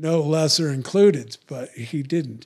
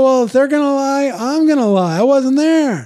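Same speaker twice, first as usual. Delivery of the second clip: defiant retort; 11 semitones higher, 9 dB louder than usual. Retold or imitated. Defiant reply to the lawyer.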